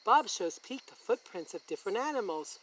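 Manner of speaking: plain reading voice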